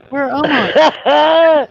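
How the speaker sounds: high, loud, long-drawn mock bewilderment; bewildered cry. Finding himself atop the temple at night, bewildered. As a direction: wail it out, acting utterly lost.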